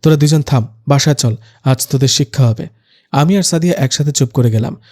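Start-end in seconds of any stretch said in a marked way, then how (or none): none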